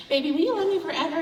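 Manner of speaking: high, fast, pleading; sweet girlfriend voice. Retold or imitated. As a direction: coo at the boyfriend for reassurance. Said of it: "I used to ask him".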